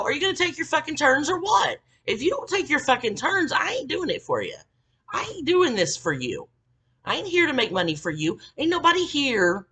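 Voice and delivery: high-pitched indignant telling-off; retold yelling. Mid-shift coworker refuses to work for her, retold scolding yell. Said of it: "the midshift girl, like, yelled at her".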